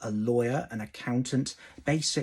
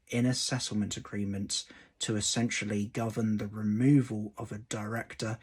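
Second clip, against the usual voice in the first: ordinary talk, nothing marked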